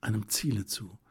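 plain reading voice

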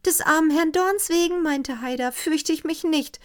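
put-on a frightened girl explaining; girl's voice. Haida, girl's voice.

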